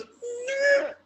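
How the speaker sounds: high, drawn-out "nyeh" of effort; high-pitched chopping noise; imitated blade swing.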